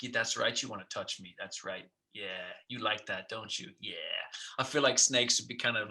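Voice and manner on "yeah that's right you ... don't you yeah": soft, oily, leering purr; hushed, slimy come-on from a smug snake; sleazy snake. Retold or imitated. Snake posing for photos, softly sleazy and smug. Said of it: "more sleazy about it".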